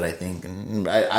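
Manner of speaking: normal talking voice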